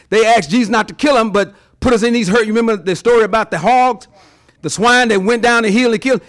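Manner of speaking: ordinary talk, nothing marked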